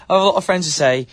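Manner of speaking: laughing. Real, not acted. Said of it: "I knew this was gonna come out".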